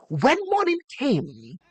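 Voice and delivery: usual speaking voice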